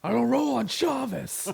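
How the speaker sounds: loud, high-pitched indignant bowler; hammed-up movie outburst, deadly serious about bowling; John Goodman. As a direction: bellow it like a bowler laying down the law. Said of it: "you get all serious".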